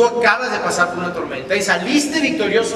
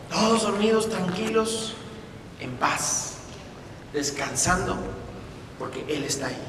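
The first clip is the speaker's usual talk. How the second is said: soft, peaceful fading voice; calm and tender; 7 dB quieter than usual. Real, not acted. Preacher painting the disciples sleeping in peace. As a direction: ease them into rest.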